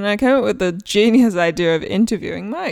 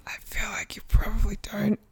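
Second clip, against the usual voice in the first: soft whispered aside; whispered thought; 9 dB quieter than usual. Retold or imitated. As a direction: whisper it to yourself. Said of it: "And I'm just thinking".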